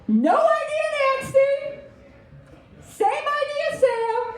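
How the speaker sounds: put-on high slow taunting kid; mocking kid voice. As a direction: sneer it like a schoolyard bully. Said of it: "he says".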